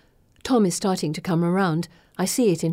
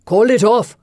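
loud, urgent shout; 4 semitones higher, 9 dB louder than usual. Acted. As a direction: yell it at the gallery. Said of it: "Kartik shouts".

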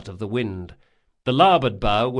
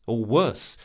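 ordinary delivery, nothing marked

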